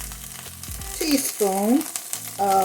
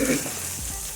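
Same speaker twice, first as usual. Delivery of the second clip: throat clearing; 11 dB quieter than usual. Real, not acted. Cough lingering for two months.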